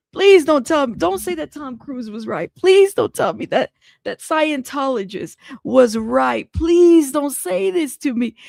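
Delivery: emphatic mock-desperate begging; pleading. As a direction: plead hard, punching each "please". Begging over Tom Cruise comparison.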